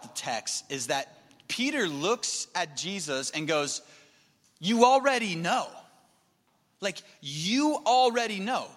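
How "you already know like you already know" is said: high, insistent exasperation; indignant, emphatic protest; frustrated. Retold or imitated. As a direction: press the point, raised pitch, exasperated. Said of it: "He's frustrated".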